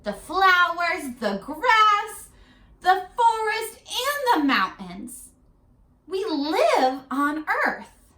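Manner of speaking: mother proudly naming each thing; mother's voice, showing. Acted. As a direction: sweep a child's eyes over the world. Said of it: "her mother said".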